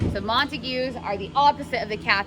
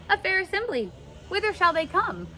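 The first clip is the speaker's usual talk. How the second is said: higher, pleased reading of the list; admiring Romeo; 9 semitones higher than usual. Acted. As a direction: savor the fair assembly, a touch theatrical. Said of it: "he says".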